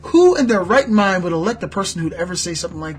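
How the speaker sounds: indignant political rant; outraged disbelief. Real, not acted. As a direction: demand an answer, incredulous and loud.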